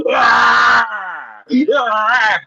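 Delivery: hammed-up outside-the-ring screams; mock frustrated screams; mock screaming. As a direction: yell like a sore loser. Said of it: "you stand outside screaming".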